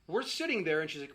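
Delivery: usual speaking voice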